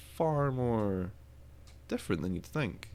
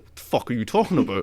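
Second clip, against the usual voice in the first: mock outrage; 2 semitones higher, 9 dB louder than usual. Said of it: "I'd be like".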